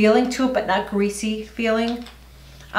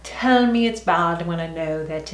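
bad English accent; slower than usual. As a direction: put on a hammy, clumsy English accent to greet the viewers. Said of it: "This is my really bad English accent".

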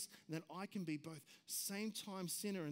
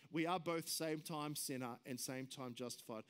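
ordinary talk, nothing marked

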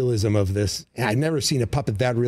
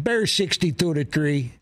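higher, cocky Chicago sports fan declaring a score; Chicago superfan accent; 5 semitones higher than usual. Retold or imitated. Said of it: "and it's like".

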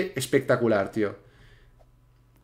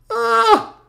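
very high, loud shriek; piercingly high "ah" of alarm; startled squeal; 5 dB louder than usual. Real, not acted. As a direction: squeal at a sudden near miss. Player startled in the jump.

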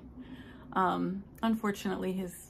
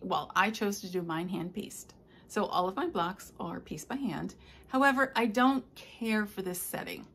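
ordinary delivery

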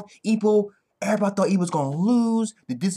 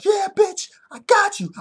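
high, crowing mock taunt; excited high-pitched gloat; triumphant taunt; 5 dB louder than usual. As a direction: crow it at a beaten rival. Acts Ippo's triumph.

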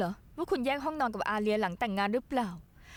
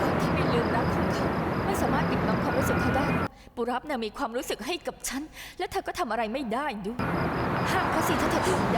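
defiant taunt. Acted. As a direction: throw the dare in her face. Rival taunting over love.

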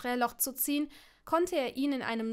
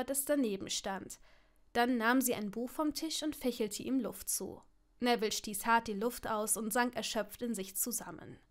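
ordinary delivery

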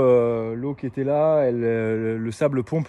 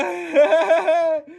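high loud burst of laughter; 3 dB louder than usual. Real, not acted. Greeting cousin, laughing.

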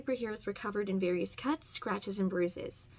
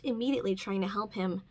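ordinary delivery

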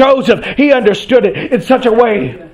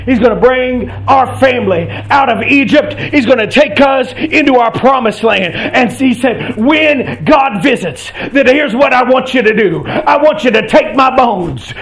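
driving sermon cadence climbing steadily higher; preaching, building to full force; 5 semitones higher than usual. Acted. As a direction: build from firm proclamation to a high shouted climax.

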